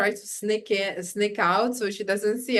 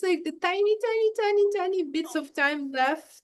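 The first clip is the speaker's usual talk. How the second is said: tiny said high; 11 semitones higher than usual. Real, not acted. Mom complaining to a friend about no time left, voice jumping high on tiny. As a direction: voice leaps way up on tiny, tiny, tiny. Stressing how little time remains.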